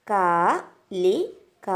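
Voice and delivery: കാലിക drawn out very slowly with a rising end; model reading. Shown as a demonstration. Teacher models കാലിക for children, very slow, rising. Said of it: "ഇതിനെ എന്ത് വായിക്കും?".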